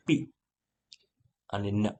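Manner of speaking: ordinary talk, nothing marked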